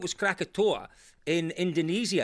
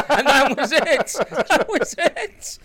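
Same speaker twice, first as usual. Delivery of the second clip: laughing; 8 semitones higher, 9 dB louder than usual. Real, not acted. Laughing at the pilot's understatement.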